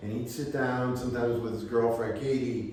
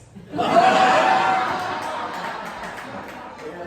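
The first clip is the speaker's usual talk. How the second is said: a big real laugh; hearty laugh; 6 semitones higher, 5 dB louder than usual. Real, not acted. Teller cracking up at his own story, laughing. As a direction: laugh out loud at the memory.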